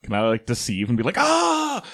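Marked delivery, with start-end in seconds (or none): 1.2-1.8 high, loud mock "Ah!"; fake pained cry. Player pretending to get hit by the attacker, fake pained cry. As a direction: cry out as if just struck. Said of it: "pretend to get hit".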